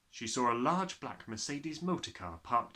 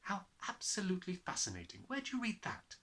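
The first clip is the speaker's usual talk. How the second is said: Miss Honey; 7 semitones higher, 5 dB quieter than usual. Acted.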